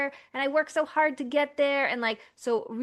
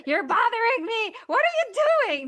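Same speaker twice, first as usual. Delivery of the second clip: high, louder mock scream; 12 semitones higher, 4 dB louder, faster than usual. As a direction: yell at the alarm clock. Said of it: "you don't start screaming at the alarm clock".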